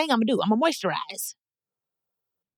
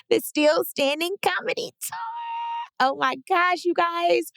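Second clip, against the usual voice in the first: higher, bubbly tour plug; excited gushing; 9 semitones higher than usual. Real, not acted. Telling fans about her tour, excited and higher.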